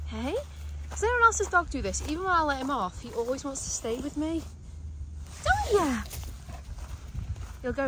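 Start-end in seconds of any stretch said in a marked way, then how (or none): 0.1-6.0 talking to the dog. Real, not acted.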